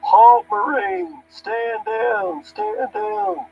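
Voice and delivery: high, drawn-out shouted order; long, high-pitched distant command; captain yelling. Retold or imitated. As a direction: yell across the tarmac to call off a Marine. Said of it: "is yelling out the window".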